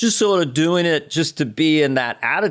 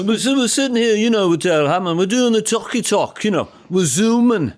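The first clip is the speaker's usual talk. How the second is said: put-on higher Liverpool accent, chatty; 5 semitones higher than usual. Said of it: "I like being [name]".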